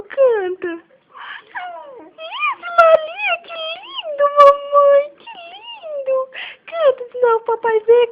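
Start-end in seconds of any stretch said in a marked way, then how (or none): 2.0-6.2 squeaky praise. Real, not acted. Mother praising baby's singing.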